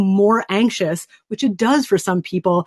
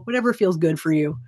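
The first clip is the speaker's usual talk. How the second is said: ordinary talk, nothing marked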